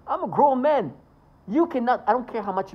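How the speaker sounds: loud, high indignant protest. Real, not acted. Speaker protesting to a manager, loud and high. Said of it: "asserting himself".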